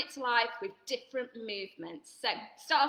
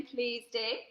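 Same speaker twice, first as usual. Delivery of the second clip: plain reading voice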